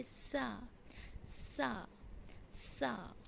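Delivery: सा breathed out unvoiced first; whispered सा demo. Shown as a demonstration. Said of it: "फिर कहिए".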